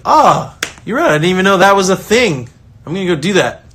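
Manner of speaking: bright, high, eager agreement; cheerful surprise. Retold or imitated. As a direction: happily take the criticism on board. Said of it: "And I'll be like".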